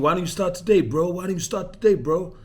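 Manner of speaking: mock Ramy accent urging a new client to start today; terrible Ramy accent. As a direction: coax him like the coach in Ramy's accent, hammed up. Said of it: "that's a terrible accent of, uh, Ramy".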